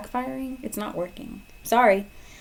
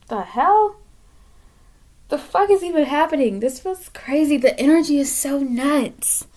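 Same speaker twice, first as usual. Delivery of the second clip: higher, rattled disbelief; high-pitched shock; 5 semitones higher, 4 dB louder than usual. Real, not acted. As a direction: let the voice jump up in shock. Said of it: "I don't talk like this".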